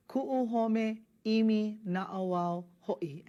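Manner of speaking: slow, careful Hawaiian line for students to copy; slow model reading. Shown as a demonstration. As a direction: read each Hawaiian word slowly and clearly. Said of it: "reads line for students to mimic".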